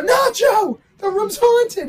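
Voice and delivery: high, loud hammed-up alarm; warning a roommate, mock-frantic shout; panicked shout. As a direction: yell it like the ghost is right there.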